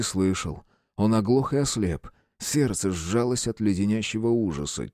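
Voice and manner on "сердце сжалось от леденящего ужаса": usual speaking voice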